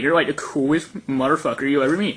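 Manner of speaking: ordinary talk, nothing marked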